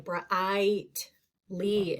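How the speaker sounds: brightly broken up as bruh-I-t, then lee; sounded-out long I. Shown as a demonstration. Teacher shows the I in the first syllable, brightly sounded out. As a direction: stretch the I inside brightly, lee set apart. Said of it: "I hear that I".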